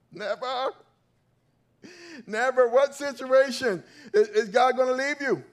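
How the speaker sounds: Never cried out high and loud; a pastor hammering home the word Never, loud and high; stress on never. Acted. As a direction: hit Never hard and high. Emphatic repetition of never.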